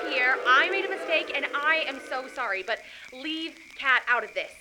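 ordinary talk

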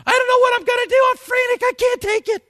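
high, loud, fast panicked shout; mock frantic panic. Believer panicking a day after posting faith, shouted. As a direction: ham up a frantic meltdown. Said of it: "you say".

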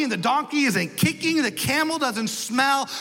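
ordinary talk

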